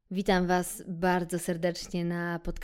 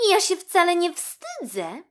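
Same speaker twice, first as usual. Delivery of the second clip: high, loud, haughty little kitten; cold tone; 13 semitones higher, 10 dB louder than usual. A narrator's reading. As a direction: answer coldly in a high child-character voice. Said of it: "powiedziała zimnym tonem Pusia". (chłodny, wysoki głos kotki)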